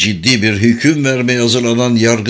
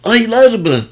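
high, incredulous burst; exploding outburst; 14 semitones higher, 4 dB louder than usual. A narrator's reading. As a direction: burst out with the question, pitched way up. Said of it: "diye patladı oğul".

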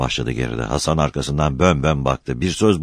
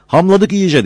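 ordinary delivery

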